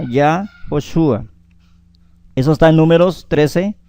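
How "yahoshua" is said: Yahoshua, slowly, with an O after Yah; pronounced with O. Shown as a demonstration. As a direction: draw out Yahoshua so the O is heard. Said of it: "con O, ¿cierto?".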